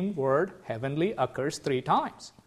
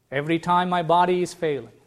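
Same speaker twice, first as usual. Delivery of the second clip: ordinary talk, nothing marked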